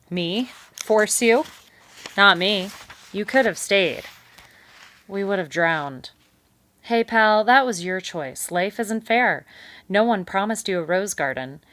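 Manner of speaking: loud, high breezy dismissal; loud, breezy beaver brushing it off; hearty beaver. A narrator's reading. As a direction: wave it off like a glib salesman. Beaver brushes off mouse's accusation.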